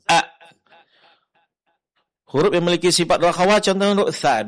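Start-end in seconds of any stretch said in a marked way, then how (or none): 0.1-0.2 "a" cut off sharply at the glottis; hamzah with sukun. Shown as a demonstration. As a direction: stop the "a" dead in the throat. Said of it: "Huruf hamzah".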